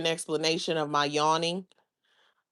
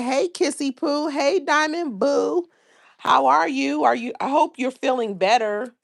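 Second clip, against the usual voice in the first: bubbly greeting; 8 semitones higher, 4 dB louder than usual. Real, not acted. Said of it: "Diamond said".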